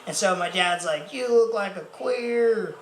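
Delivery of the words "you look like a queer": slow, high Southern drawl; super Southern dad. Retold or imitated. Dad mocks ginger friend's sun hat, Southern drawl. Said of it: "'cause he's super Southern".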